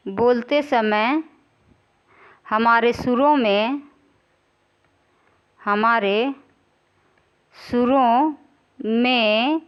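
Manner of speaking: slow, drawn-out words with long pauses; slow dictation. Real, not acted. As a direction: say it piece by piece as you write. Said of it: "यहाँ लिख देते हैं".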